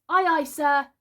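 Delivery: high, loud eager obedience; keen crew member's reply; dutiful salute. A narrator's reading. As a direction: answer the captain smartly, high and loud. Boy answers captain's order.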